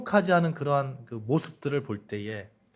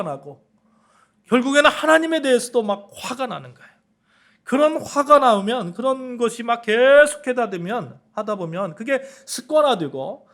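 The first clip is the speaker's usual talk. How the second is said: rising, fervent sermon delivery; preaching at full force; 7 semitones higher, 5 dB louder than usual. Acted. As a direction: voice climbing higher and louder through the build. Sermon rising to fever pitch.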